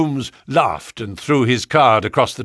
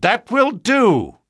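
higher, louder and slow, cutting him off; sharp cry; 10 semitones higher, 5 dB louder, slower than usual. A narrator's reading. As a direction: stop the rambling with a sharp command. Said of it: "cried Holmes".

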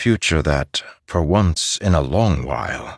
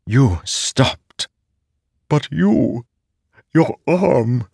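put-on much higher, childlike sword voice; a remorseful living sword; talking sword; 8 semitones higher, much slower than usual. Nightblood voice.

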